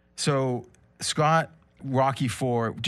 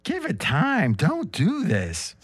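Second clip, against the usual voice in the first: Marge Simpson-ish Adrian; slower than usual. Acted. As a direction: plead slowly, a little Marge Simpson. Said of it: "You sound like Marge Simpson".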